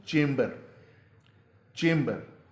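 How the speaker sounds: चेम्बर as CHAYM-ber, falling; English pronunciation. Shown as a demonstration. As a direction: model चेम्बर with a long "ay". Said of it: "अंग्रेज़ी में जो इसका तलफ़ुज़". (चेम्बर, लंबे "ए" के साथ)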